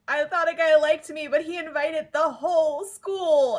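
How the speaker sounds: high, slow whiny quote; mock-whiny girl voice; quoting Serena. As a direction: whine it like a hurt schoolgirl. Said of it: "she's like".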